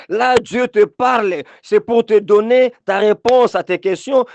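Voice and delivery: insistent preacher's exhortation; emphatic preaching. Real, not acted. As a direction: press the point home to the congregation.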